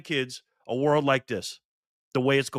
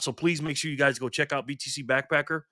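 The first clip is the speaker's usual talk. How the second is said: ordinary delivery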